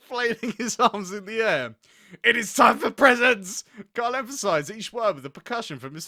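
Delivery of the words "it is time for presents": mock yell. A narrator's reading. Carl slamming head, yelling.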